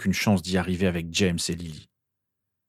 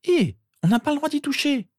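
put-on very high, alarmed girl; protesting sister. A narrator's reading. Sister protesting as Harry grabs her wrist, alarmed. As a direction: forbid him in a high-pitched voice.

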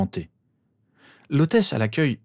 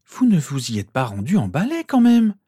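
high, loud cry of disbelief; shocked exclamation; 7 semitones higher, 5 dB louder than usual. A narrator's reading. Mother to boys about flying to hospital, crying out in shock. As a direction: cry out, rising high at the end. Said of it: "s'écria Lily".

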